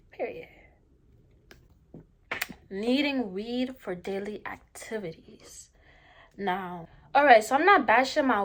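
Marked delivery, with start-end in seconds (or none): 2.7-5.7 hushed whispered title; whispering. Real, not acted. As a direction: whisper the next topic. Whispered topic announcement.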